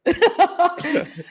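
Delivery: imitating a very high cackling laugh; a high mocking cackle. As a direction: mimic the boss cackling along. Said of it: "Dana's just sitting there like".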